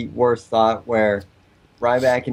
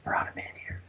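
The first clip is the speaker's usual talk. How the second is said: hushed whisper, no voice at all; whispering character voice; 14 dB quieter than usual. Pretend. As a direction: breathe it as a creepy whisperer. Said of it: "We have somebody here".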